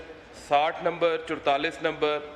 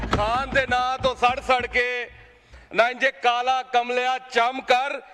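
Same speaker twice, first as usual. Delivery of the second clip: building poetic declamation; 7 semitones higher, 4 dB louder than usual. Acted. MP taunts Imran Khan's critics in verse, rising declamation. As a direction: build the couplet up into a triumphant retort. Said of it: "sir मैं एक शेर पढ़ के".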